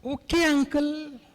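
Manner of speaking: lightly voiced small child, very slow; a hint of a toddler drawing the words out; three-year-old child. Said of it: "बच्चे ने phone उठाया". (बच्चे की हल्की नकल, बहुत धीमी)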